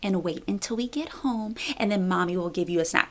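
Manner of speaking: soft unvoiced whisper, gently patient; calm whisper. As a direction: hush it like soothing a toddler. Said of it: "all calm and patient".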